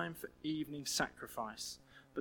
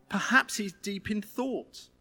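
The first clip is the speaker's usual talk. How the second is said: slow, higher sarcastic ponder; mock musing; 5 semitones higher, 5 dB louder, slower than usual. Elijah mocking the prophets about Baal, slow sarcasm. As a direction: drag it out in fake wonder. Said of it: "he goes on to say".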